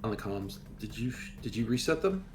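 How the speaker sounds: ordinary talk, nothing marked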